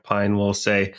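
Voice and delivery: ordinary talk, nothing marked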